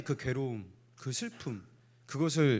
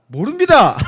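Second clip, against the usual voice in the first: mock loud reply; 10 semitones higher, 15 dB louder than usual. Playing congregation's answer.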